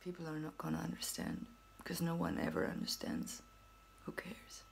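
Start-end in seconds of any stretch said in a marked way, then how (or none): 4.0-4.6 whispered shrug of an aside; dismissive whispered aside. Real, not acted. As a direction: toss it off in a whisper.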